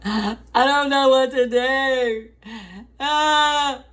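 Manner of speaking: high, loud panicked wail, imitated; imitating scream crying. Calling mom about the turkey, imitating her scream crying. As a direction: relive the meltdown, half-laughing at herself. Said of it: "I was, like, scream crying".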